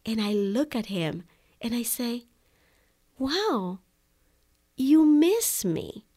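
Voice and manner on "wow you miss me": realizing wow. Retold or imitated. Realizing husband misses her.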